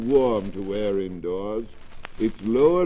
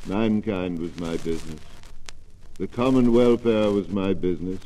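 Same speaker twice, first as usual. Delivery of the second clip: unearthly voice. Acted. Ghost cries out, rising.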